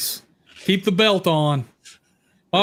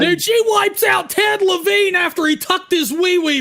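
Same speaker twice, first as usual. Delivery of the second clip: excited shout; 10 semitones higher than usual. Real, not acted. Heated ranking argument.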